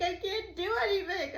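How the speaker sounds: high-pitched helpless wail, re-enacted; retold hysterical. Driver stuck mid-intersection, retold hysterical. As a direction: act out helpless panic. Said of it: "hysterical. I'm, like, freaking out".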